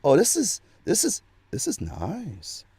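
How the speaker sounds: slowing, softening oh this is nice; pleased discovery. Retold or imitated. Believer discovering they like the new path, pleased. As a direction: savor it as it dawns on you. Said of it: "you go".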